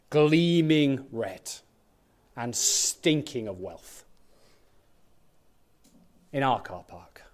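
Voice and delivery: low, hushed near-whisper; hushed awe. Pretend. Preacher imagines a Ferrari in the church car park, hushed awe. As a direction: drop to a whisper, as if marvelling.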